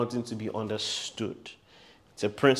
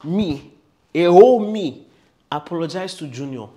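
indignant parent; 6 semitones higher, 12 dB louder than usual. Retold or imitated. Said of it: "some parents can't even fathom it".

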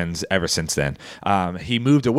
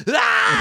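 very high mock squeal; high mock shriek; toddler shriek. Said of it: "she's just like".

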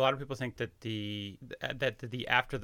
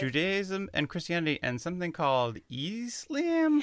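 mock clueless aside; 7 semitones higher than usual. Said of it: "mock of own phrasing".

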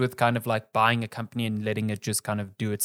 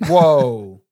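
surprised whoa; 12 dB louder than usual. Real, not acted.

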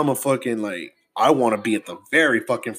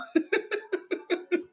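breathy high laugh; 10 dB quieter than usual. Real, not acted. Laughs after mock fright.

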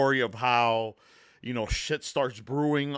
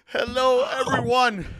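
podcast host intro; 10 semitones higher than usual. Acted. Show host greeting.

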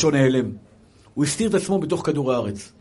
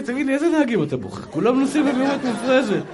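retold officer's complaint, pitched up high; acted scolding policeman; 10 semitones higher than usual. Policeman pulls over speeding passenger, mock scolding. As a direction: imitate an exasperated cop, high-voiced.

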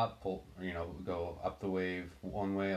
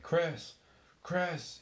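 retold scream; 10 semitones higher than usual. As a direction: yell the name up to him over the storm. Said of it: "I screamed up to him".

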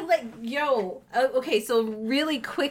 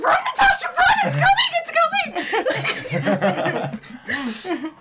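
high, loud scared scream; panicked screaming; 7 dB louder, slower than usual. Retold or imitated. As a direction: shriek at her to run. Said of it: "Dude, I am screaming".

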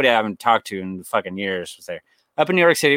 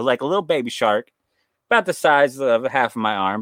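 ordinary delivery

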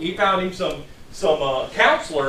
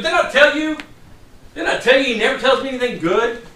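higher, louder vindicated protest; indignant I-told-you-so; 6 semitones higher, 5 dB louder than usual. Acted. King complaining to his ally about the prophet, indignant. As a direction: throw it back, raised and indignant.

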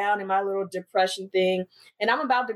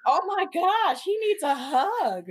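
exasperated sympathy; 7 semitones higher, slower than usual. Retold or imitated. Acted exasperated reaction to sobbing stranger.

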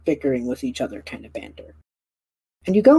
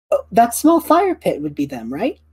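curious Yeti; 8 semitones higher, 3 dB louder than usual. Acted. Yeti pointing out fire pit.

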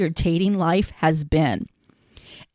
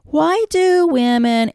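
mocking quote; 6 semitones higher, 6 dB louder, slower than usual. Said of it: "begins with the words".

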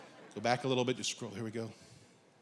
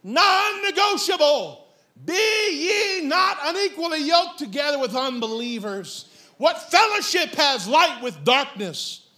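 forceful preaching; 3 semitones higher than usual. Real, not acted. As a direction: lay down the law from the pulpit. Thundering a scripture at congregation.

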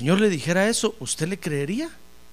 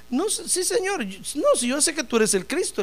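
much higher, fumbling the words; flustered high reply; 8 semitones higher than usual. Acted. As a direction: stumble over yourself, eager to agree. Marta flustered answering Jesus.

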